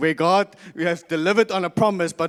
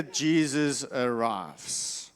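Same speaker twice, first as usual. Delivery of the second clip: slow dramatic drop; 6 semitones lower, 5 dB quieter, much slower than usual. Acted. Dramatic pause in story.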